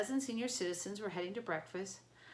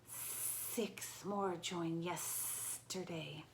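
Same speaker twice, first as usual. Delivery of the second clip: very slow, softer reptilian hiss; 4 dB quieter, much slower than usual. A narrator's reading. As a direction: drawl it like a cold serpent. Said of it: "a reptilian voice came down from the corridor".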